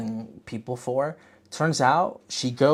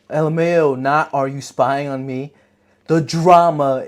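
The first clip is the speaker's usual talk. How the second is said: louder, hyped-up reading of her note; excited note reading; 10 dB louder than usual. Retold or imitated. Viewer reading her caps-lock note, louder and excited. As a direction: shout it like a gossip fan. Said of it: "My next series of notes goes".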